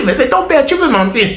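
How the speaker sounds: high voice through laughter; laughing. Real, not acted. Joker admitting the father line is a lie, laughing. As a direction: crack up while talking, pitch jumping up.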